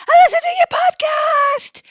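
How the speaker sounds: high, loud squeal out a car window; screeching fan mum calling from her car; excited shout. Retold or imitated. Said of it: "she's like".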